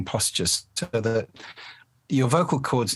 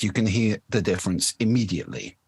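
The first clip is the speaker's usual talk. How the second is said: normal talking voice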